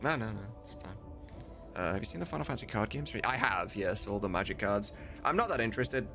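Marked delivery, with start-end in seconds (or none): none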